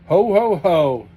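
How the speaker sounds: loud, slow, beaming ho-ho-ho; joyful shout. A narrator's reading. Sergei hearing he can stay, shouting with joy. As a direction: burst out with relieved joy. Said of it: "Sergei was shouting".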